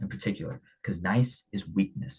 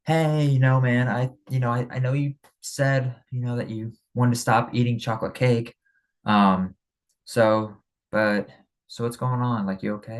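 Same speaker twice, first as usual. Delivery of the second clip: higher, hesitant, hedging mock gentleness; tiptoeing hesitant; 4 semitones higher than usual. To a friend eating cake, mock hesitant tiptoeing. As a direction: pussyfoot around it with ums and stalls. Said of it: "you don't wanna be tiptoeing and saying".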